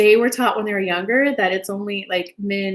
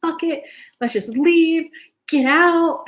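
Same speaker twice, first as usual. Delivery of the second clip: high, slow mock outburst, louder at end; imitated irate yelling; 7 semitones higher, 3 dB louder, slower than usual. Retelling an irate person telling others to leave, mock exasperated yell. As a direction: mimic someone fed up and irate. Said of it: "getting more and more irate".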